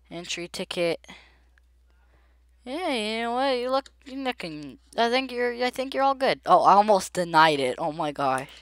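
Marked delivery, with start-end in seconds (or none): 2.6-6.4 playful high voice; high-pitched approval. Pretend. Player clears a traveler at the border, high playful approval. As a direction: lift the voice high and wave them through. Lightly waving traveler through.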